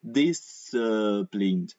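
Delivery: very slow, drawn-out disciplined; teacher's slow-motion disciplined; over exaggerated. Shown as a demonstration. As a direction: stretch every sound of disciplined. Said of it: "very over exaggerated".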